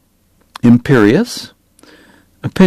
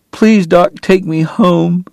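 higher, slow, aching plea; pleading; 7 semitones higher, slower than usual. Acted. Kate begs Doc to take her home, high and slow. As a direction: beg him, voice lifting on please.

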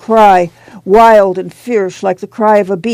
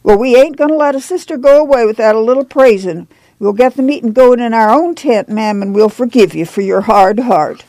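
quick, higher-pitched pious rebuke; affronted churchwoman; 4 semitones higher, faster than usual. Acted.

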